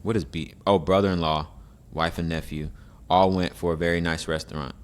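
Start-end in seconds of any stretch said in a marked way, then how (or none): none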